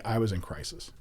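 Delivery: normal talking voice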